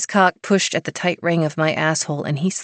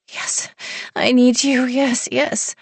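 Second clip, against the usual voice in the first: higher, pleading; breathy begging; 8 semitones higher, slower than usual. A narrator's reading. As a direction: beg him eagerly. Heroine begging.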